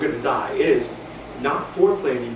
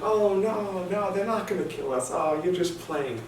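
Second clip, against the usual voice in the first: playful dismissal; 7 semitones higher than usual. Retold or imitated. Playfully dismissing her mafia fear.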